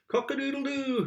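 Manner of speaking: high rooster crow. Shown as a demonstration. Reading the rooster sound back, crowing high. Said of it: "He can sound like a rooster".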